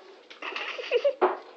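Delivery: unvoiced high laughter; breathy laugh. Real, not acted. Laughing again.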